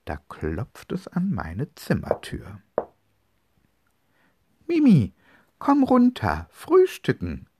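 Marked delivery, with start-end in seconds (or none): 4.7-7.5 high, loud call up the stairs; mother calling. Acted. Mother calls her daughter down to breakfast, loud and high. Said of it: "ruft meine Mutter".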